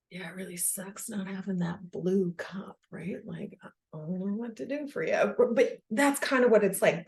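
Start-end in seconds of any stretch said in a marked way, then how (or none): 0.1-5.3 much softer, gentle sympathetic murmur; soothing parent. Retold or imitated. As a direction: soothe a crying toddler softly. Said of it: "You're like".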